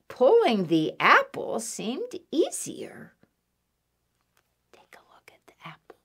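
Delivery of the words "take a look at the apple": quick whispered aside. Real, not acted. Storyteller pointing children to the apple, whispered. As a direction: whisper it very quietly and fast.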